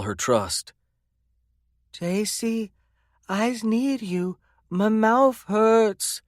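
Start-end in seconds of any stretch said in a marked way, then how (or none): 1.9-6.2 put-on high, slow little-girl voice, scared but brave; slow small-child drawl, frightened yet steady; little girl's drawl. A narrator's reading. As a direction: speak as a hurt small child holding it together. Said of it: "in that little drawl of hers, her voice scared but strong".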